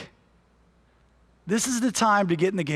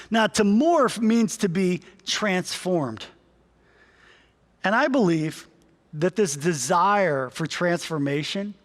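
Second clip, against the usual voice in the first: normal talking voice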